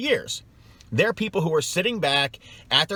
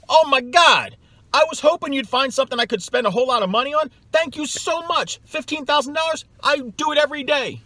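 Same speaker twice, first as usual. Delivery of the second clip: much higher, gushing mock delight, loud at the start; mock overjoyed customer; 10 semitones higher, 4 dB louder than usual. Salesperson imagining the customer's thrilled reply, in a high hammed-up gush. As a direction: ham up a thrilled customer begging to spend. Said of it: "they think the customer's gonna go".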